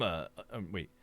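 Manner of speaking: ordinary talk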